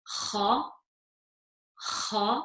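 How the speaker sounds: kha with a rasp from the top of the throat; kha said with a scratch at the back of the throat; scratchy kha. Shown as a demonstration. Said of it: "with a scratch to it".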